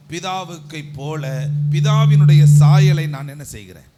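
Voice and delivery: loud, emphatic preacher's delivery; forceful preaching. Real, not acted. Preacher building a point about becoming like the Father, forceful preaching. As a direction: drive each phrase home loudly.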